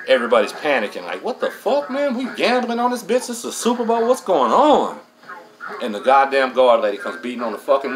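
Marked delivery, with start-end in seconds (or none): 1.2-5.0 high, startled outcry of confused men; imitating panicked inmates. Inmates whose Super Bowl was cut off, high panicked outcry. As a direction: squeal it out like the whole room just lost the game.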